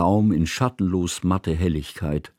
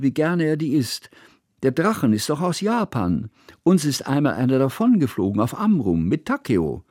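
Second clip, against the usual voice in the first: lightly voiced child, raised and chattering; a girl explaining eagerly, a touch higher; eager young girl; 6 semitones higher than usual. A narrator's reading. Child figure voiced by narrator. (Hanaka erklärt Johanna eifrig, leicht kindlich erhöht)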